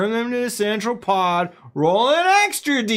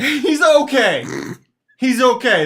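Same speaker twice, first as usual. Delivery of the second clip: dry mock-modest, higher; ironic understatement; 7 semitones higher, 4 dB louder than usual. Host mocking a huge baseball star. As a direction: undersell it with a wink.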